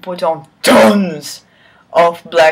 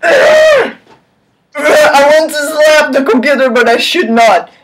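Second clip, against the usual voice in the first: exasperated outburst; 8 semitones higher, 5 dB louder than usual. Real, not acted. Reader exasperated at the text.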